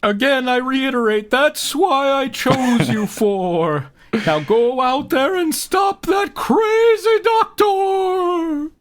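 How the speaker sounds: slow, high, loud divine command; booming deity voice. Acted. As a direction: proclaim it like a god sending heroes off.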